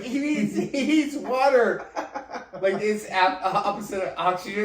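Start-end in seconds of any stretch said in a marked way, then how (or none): 0.0-1.9 high, loud, slow urgent plea; mock panicked bystander. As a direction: plead like a frantic rescuer.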